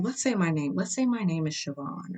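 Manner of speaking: usual speaking voice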